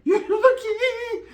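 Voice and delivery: very high, drawn-out singsong with laughter; squealed mockery. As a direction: stretch it into a laughing falsetto taunt. Repeats line in squealed mockery.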